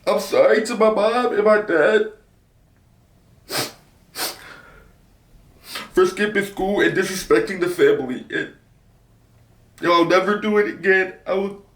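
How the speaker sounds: reading the apology for the video; reciting apology. Retold or imitated. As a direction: rattle off a forced apology. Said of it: "the apology was like".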